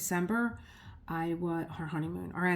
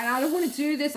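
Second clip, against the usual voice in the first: higher, stammered mock-tearful plea; retold crying protest; 7 semitones higher, 4 dB louder, faster than usual. Patient to technician before the iodine, mock tearful. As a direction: act out a tearful refusal, pitched up. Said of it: "I'm crying".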